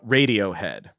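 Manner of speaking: ordinary talk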